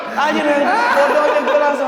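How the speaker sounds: slow, laughing in disbelief. Real, not acted. Laughing while telling.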